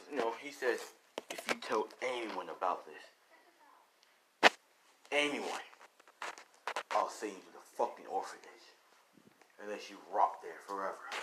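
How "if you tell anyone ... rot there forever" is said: low, quiet father's threat; menacing threat. Acted. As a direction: drop low and threaten under your voice. Father threatens son to stay silent.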